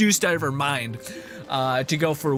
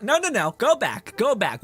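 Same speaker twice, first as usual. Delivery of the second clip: mock protest; 7 semitones higher than usual. Mock scolding of game character.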